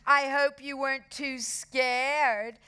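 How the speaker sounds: high, mock-sweet needling; smug girl's false concern; smirking. A narrator's reading. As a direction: tease with a smirk, lingering on the end.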